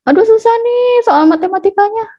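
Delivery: put-on high-pitched child's whine over homework; complaining child. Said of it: "kalau misalnya anak juga bilang". (keluhan anak kecil bernada tinggi)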